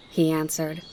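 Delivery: ordinary delivery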